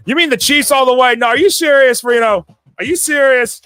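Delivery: high, loud disbelief; can't believe the pick; teasing disbelief at a viewer's choice; incredulous. Real, not acted.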